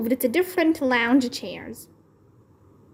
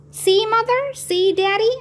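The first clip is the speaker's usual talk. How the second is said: high calling out to her parents; 9 semitones higher than usual. A narrator's reading. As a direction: call across the pool, bright and high. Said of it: "I called".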